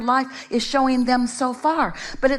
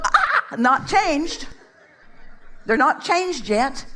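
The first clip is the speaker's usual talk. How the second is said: higher-pitched mock alarm on finding no change; a hint of acted shock, pitched up; mock dismay; 4 semitones higher, slower than usual. Speaker mimics someone checking whether others have changed, mock dismay.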